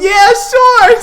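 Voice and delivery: high, loud squealed yes; eager agreement. Retold or imitated. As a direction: imitate an over-eager star agreeing, half laughing. Star eagerly agrees, teller laughing.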